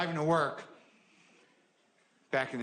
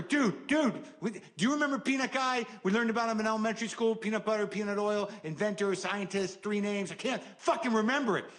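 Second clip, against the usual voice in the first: high, fast frantic questioning; loud sharp voice; 7 semitones higher than usual. Retold or imitated. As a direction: rattle it off, worked up and high. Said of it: "Why are you yelling?".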